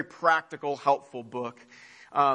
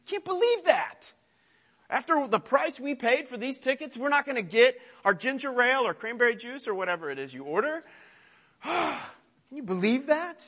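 much higher, huffy mock complaint with a sigh; petty outrage; 9 semitones higher than usual. Passenger denied a drink mid-flight, much higher mock outrage. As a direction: play the entitled passenger griping. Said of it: "And you say".

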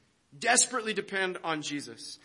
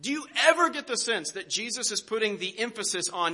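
ordinary talk, nothing marked

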